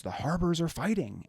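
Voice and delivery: retold shout. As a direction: call out the news like a guard rushing past. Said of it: "and he shouts".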